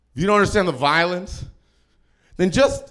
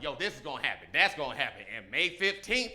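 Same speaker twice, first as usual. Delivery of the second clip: much softer, low, matter-of-fact; grim expert; 4 semitones lower, 12 dB quieter than usual. Retold or imitated. Podcast expert quietly laying out bad news. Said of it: "He was like".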